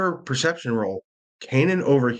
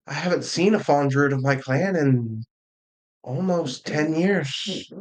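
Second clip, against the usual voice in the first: higher, lilting character voice; Janice; 4 semitones higher than usual. Acted. Game master plays Janice in a remembered meeting, higher character voice. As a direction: voice a character gently recounting being the last one, pitched up. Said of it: "you hear Janice's voice".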